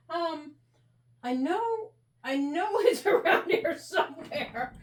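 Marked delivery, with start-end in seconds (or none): 2.2-4.7 higher, giggling while talking; laughing. Real, not acted. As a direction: giggle through the search. Still searching, giggling.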